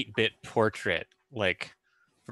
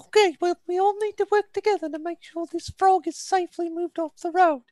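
much higher squeaky mouse voice; high character voice; 4 dB louder than usual. Acted. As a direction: pitch up into a tiny mouse.

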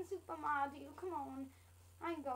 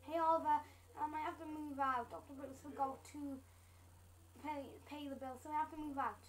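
ordinary delivery

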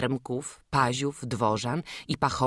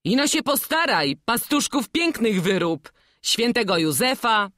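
high, louder encouraging call; eager painter; 8 semitones higher, 6 dB louder than usual. Acted. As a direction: urge the boy to carve well. Painter urges the boy.

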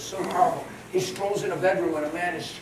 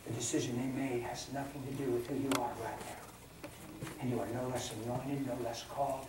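tender counsel; 5 semitones lower, 11 dB quieter than usual. Acted. Pastor counsels grieving person softly.

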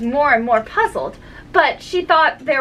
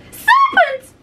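piercing high scream of accusation; very high, loud bird screech; 7 dB louder than usual. A narrator's reading. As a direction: shriek it out like a panicked bird. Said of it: "screamed the pigeon".

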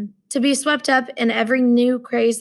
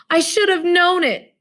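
higher, louder anguished cry; cried out; 8 semitones higher, 4 dB louder than usual. Acted. Roommate crying out in self-reproach, higher and louder. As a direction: cry it out in despair. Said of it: "he cried".

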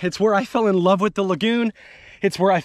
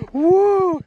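high celebratory whoop; elated whoop; 12 semitones higher, 3 dB louder than usual. Real, not acted. Angler celebrating the catch, elated whoop. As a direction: let out a high woo of joy.

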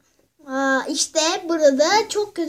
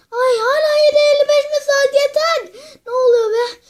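frantic outburst; 8 semitones higher, 6 dB louder than usual. Acted. Panicked at stuck clock.

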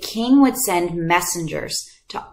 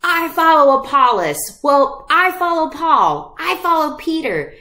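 higher, bickering voices claiming their leader; mock rival fans arguing over favorites; squabbling churchgoers; 7 semitones higher than usual. Church members in Corinth squabbling, bickering mock voices. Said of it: "they were saying".

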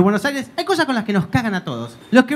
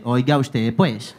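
much lower Colombian paisa drawl; Pablo Escobar; 11 semitones lower, much slower than usual. Retold or imitated. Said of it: "Pablo Escobar con ese". (Pablo Escobar, acento paisa grave)

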